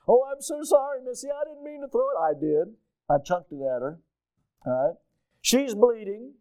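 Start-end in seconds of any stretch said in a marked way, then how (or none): 0.1-2.2 high, hammed-up fake apology; boy fakes comfort for his hurt sister, high and sugary; mock consoling. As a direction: pretend to be sorry in a high sweet voice. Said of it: "pretending to be consoling".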